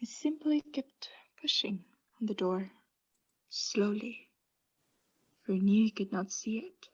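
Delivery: soft, secretive whisper; whispered narration. Acted. Madman confessing how he crept in, whispering. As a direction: creep through the words barely voiced.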